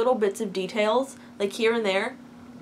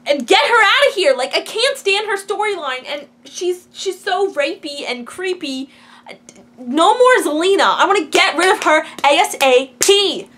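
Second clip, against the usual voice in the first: angry rant; 6 semitones higher, 8 dB louder than usual. Real, not acted. Ranting about a hated character.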